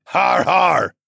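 put-on loud, high old man's har har; hearty cheer. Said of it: "Another yell from Tovish".

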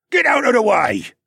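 high, loud, forceful command; shouted order. A narrator's reading. Nawel to the hero, ordering loudly. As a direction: bellow it to clear a path. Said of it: "Nawel ordered".

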